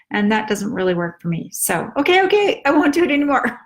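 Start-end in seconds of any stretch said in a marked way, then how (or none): 2.0-3.6 much higher and louder, eager; mother promising again. Retold or imitated. Mother promising her daughter to stop, high and eager. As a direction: hurry to reassure like a scolded mom.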